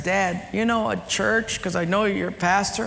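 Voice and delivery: put-on sweet child explaining. Son explaining gift.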